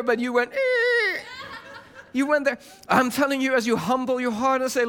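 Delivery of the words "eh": very high, drawn-out "eh"; silly swerve noise; comic squeal. Retold or imitated. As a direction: imitate veering off with a squeal. Imitates veering the wrong way.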